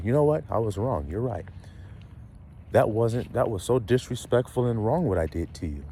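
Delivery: soft, low, subdued admission; imagined apology. Acted. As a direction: own up quietly, humbly. Acts out an imagined repentant apology.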